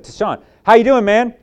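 loud, high friendly hail; hearty greeting. Retold or imitated. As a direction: call out a cheery hello across the room. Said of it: "I said".

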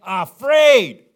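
high, loud single word; loud emphatic answer; punchy answer. Acted. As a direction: hit it like a reveal. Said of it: "The Philistines were what?".

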